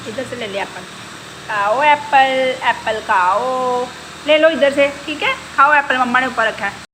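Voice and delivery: loud, high scolding of a cheeky pet. Real, not acted. Mother scolding her parrot for calling her Pinky, loud and high. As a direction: tell the parrot off loudly.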